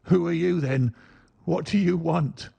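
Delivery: a hint of shaky fright; quavering. Startled little man to Holmes, quavering. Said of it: "he asked in a quavering voice".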